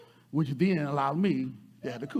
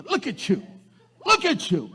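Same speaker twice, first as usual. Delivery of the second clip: higher, louder mock accusation; accusing outburst; 11 semitones higher, 5 dB louder than usual. People reminding her who she's not, accusing outburst. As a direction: hurl it like a pointed finger. Acted accusers pointing the finger.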